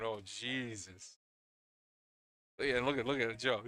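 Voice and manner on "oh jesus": long, drawn-out mock dismay; imitated dismay. Commentator mimics both teams' reaction to the shot, drawn-out dismay. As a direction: slowly drag it out like both deflated teams. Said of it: "both teams just going".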